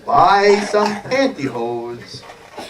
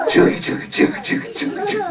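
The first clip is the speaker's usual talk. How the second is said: voiceless rhythmic steam-engine chuffs; train chugging; 13 semitones higher than usual. Acted. Feeding kitty like a train, playful chuffing. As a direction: chug like a little train. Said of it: "here comes a choo-choo train".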